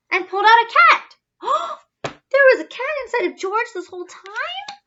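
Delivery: fast, high, loud reveal; astonished. A narrator's reading. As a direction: gasp at the surprise. Shocking reveal.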